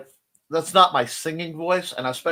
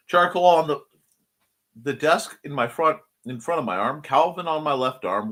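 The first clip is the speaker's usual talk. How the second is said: usual speaking voice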